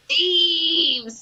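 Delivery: high monster screech; a wyvern screaming at the party. Acted. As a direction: shriek like a furious beast. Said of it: "screams the wyvern".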